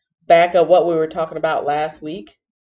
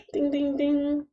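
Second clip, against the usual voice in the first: chime imitation; 8 semitones higher, 8 dB quieter than usual.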